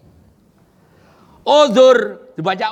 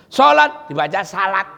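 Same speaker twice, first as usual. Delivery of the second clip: ordinary talk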